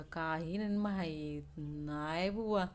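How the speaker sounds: put-on slow, soft drunk voice; drunkard. Fallen drunk answers the people gathered round, slow and soft. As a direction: draw it out quietly like a drunk. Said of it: "बेवडा".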